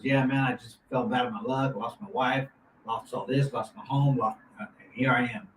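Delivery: low, subdued, downcast delivery; retold hard-luck plea. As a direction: quietly recount your losses as a down-and-out man. Voices homeless man's hard-luck story.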